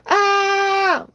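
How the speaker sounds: long piercing high shriek; toddler's scream. Retold or imitated. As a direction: wail at full volume like a tantruming kid.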